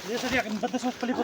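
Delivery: fast, loud, high hurry; urgent shout. Real, not acted. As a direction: rush everyone with a shout. Nagmamadali sa operasyon. (madaliang sigaw)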